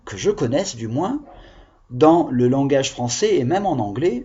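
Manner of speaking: ordinary delivery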